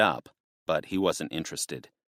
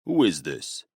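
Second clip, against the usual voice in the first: deep male voice; 3 semitones lower than usual. A narrator's reading. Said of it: "a man's deep voice replied".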